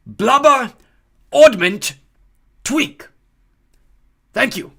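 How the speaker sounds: Dumbledore speaking. A narrator's reading. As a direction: deliver nonsense words grandly. Dumbledore's speech.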